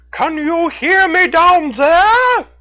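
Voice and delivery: high, loud raccoon shout, drawn out; calling down. A narrator's reading. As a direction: call down from the waterfall, high and slow. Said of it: "Red the Raccoon shouted down to her".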